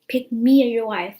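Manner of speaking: loud, mocking shout of a clingy girlfriend; mock jealous demand. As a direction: belt it out as a hammed-up ultimatum. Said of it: "those females that's gonna be like".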